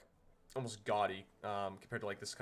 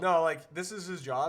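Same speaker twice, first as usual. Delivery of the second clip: loudly insisting; emphatic loud; 7 semitones higher, 12 dB louder than usual. Real, not acted. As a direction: push it out loud and firm. GM emphatic reassurance.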